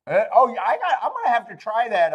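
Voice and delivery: chuckling through the words; laughing. Real, not acted. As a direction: laugh as you read it out. Laughing while reading chat.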